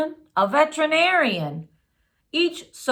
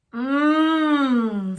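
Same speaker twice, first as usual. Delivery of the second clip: a contented hum of taste; pleased mmm; 2 semitones higher than usual. A narrator's reading. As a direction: savor it with a happy hum. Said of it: "said Camilla".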